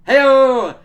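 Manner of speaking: high, very loud celebratory holler; big drawn-out high call; loud whoop. Pretend. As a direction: belt it out like a punchline cheer. Calling out to cue next scene.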